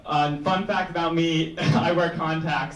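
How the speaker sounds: ordinary talk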